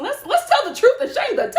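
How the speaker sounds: laughing. Real, not acted. Laughing, high.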